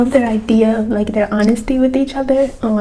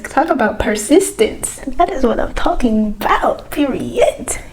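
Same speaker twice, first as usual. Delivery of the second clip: ordinary talk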